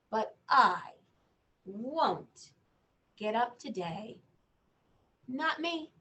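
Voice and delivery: lightly voiced stubborn boy reading; reading to children. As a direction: read the boy's refusal to a child. Reads picture book aloud.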